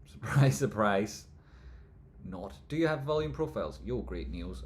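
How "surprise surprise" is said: laughing through a mock surprise; chuckle, then louder and slower surprise; amused mock astonishment; laughing sarcastic. Real, not acted. Repeats with laugh.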